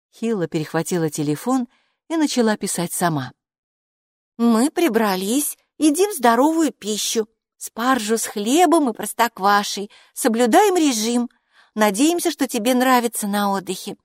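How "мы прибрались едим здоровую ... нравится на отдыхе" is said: high voice dictating phrase by phrase; composing a message. Acted. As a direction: dictate a reassuring letter in a raised voice.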